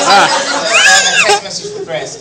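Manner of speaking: high, loud words through laughter; laughing announcement. Real, not acted. Host starts reading Chris's text, laughing loudly. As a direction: announce it while cracking up.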